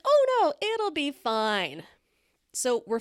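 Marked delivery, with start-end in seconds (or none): 0.0-1.8 sudden high squeal on oh no, then slow and easy; breezy girlfriend reassurance. Retold or imitated. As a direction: brush off the worry like it's nothing. Girlfriend voiced dismissing worry.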